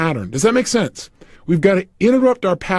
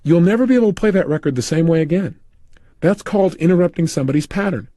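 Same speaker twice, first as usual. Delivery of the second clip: ordinary delivery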